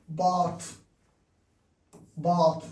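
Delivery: bath said slowly with a long open British a, like bahth; bath in RP, drawn-out broad ah vowel; British vowel demo. Shown as a demonstration. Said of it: "practice vowel sounds like".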